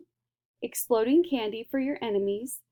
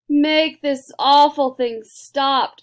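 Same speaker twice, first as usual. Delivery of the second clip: much louder, slow command; loud order; 5 semitones higher, 11 dB louder, much slower than usual. A narrator's reading. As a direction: demand it stops, loud and slow. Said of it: "ordered Mister T V".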